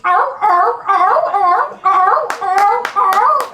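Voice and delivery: high, loud barking "arr" calls like a sea lion. Retold or imitated. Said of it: "like a chorus of harpies just cackling".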